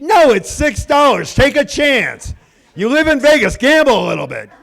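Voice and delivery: loud, high exasperated shout; worked-up comic outrage; yelling. Retold or imitated. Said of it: "quit yelling at people".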